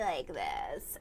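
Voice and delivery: low, soft creaky millennial voice; vocal fry. Retold or imitated. Imitating a castmate on tour, annoying vocal fry. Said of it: "vocal fryer".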